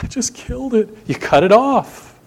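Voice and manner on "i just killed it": high, alarmed little cry of worry; mock dismay. Gardener who just pruned the vine, mock alarmed. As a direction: play the panicked gardener. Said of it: "You cut it off and you think".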